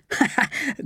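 short high chuckle; acted, jolly little chuckle. A narrator's reading. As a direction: chuckle as the character. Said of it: "Bernie chuckled".

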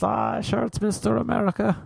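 high-pitched greeting voice; high, mock-admiring chirp; admiring locals. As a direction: go up high, imitating fans who recognise you. Acts out people greeting him.

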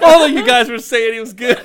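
very high and loud through laughter; high hammed-up voice laughing; laughing squeaky excuse. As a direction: giggle out his excuse.

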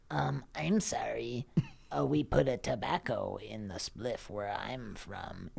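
whispered, soft apology; sheepish little guy whispering; meek whisper, slow; Smallman voice. Retold or imitated. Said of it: "Smallman go".

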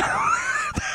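high squealing burst of laughter; a shrill cackle; squealing laugh at the prank; shrieking laugh. Real, not acted. Laughing at her stunt.

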